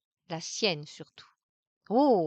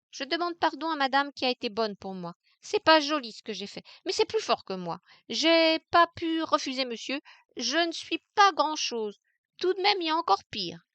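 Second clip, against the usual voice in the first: higher, quick half-defiant apology; sorry but still bold; softened apology; 7 semitones higher, 4 dB louder than usual. Acted. Maid apologising to madame, higher and quick. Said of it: "audacieuse encore, mais les yeux adoucis".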